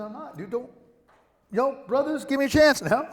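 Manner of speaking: high-pitched, rising to loud appeal; acted protesting plea. Man begging his teasing friends for a chance, high protesting voice. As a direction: plead with them in a high, exasperated voice.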